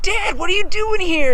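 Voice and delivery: high, loud, delighted surprise; surprised greeting. Acted. Junior Bear finds his dad at school, surprised greeting. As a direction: exclaim on spotting your dad.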